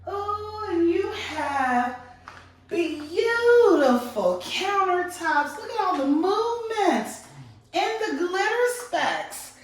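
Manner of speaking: real delight at the stone; excited gushing. Real, not acted. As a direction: swoon over the countertops. Gushing over bathroom countertops.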